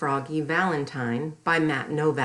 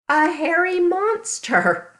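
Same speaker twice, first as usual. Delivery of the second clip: higher, louder, slower reveal; drawn-out loud monster reveal; dramatic reveal; 12 semitones higher, 6 dB louder, slower than usual. Acted. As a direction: make the monster a big surprise.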